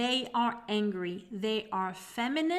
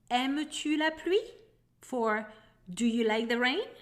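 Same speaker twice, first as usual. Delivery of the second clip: normal talking voice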